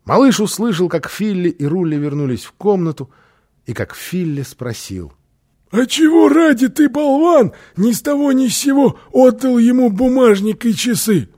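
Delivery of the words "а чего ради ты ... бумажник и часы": high, loud, scolding character voice; outraged high-pitched demand; angry squabbling. A narrator's reading. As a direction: berate someone in a raised, high-pitched voice. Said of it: "Услышав их ругань".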